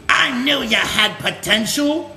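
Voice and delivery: put-on proud old soldier, half laughing; a hint of a gruff mentor, laughing; old mentor line. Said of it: "Cup behind goes".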